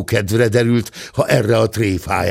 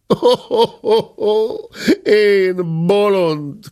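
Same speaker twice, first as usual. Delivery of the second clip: mock self-reproach cry; 10 semitones higher, slower than usual. A narrator's reading. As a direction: cry out at your own foolishness. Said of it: "kiáltotta". (önmagát szidó felkiáltás)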